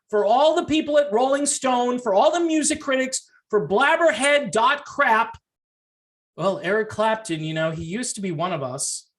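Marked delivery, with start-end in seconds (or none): none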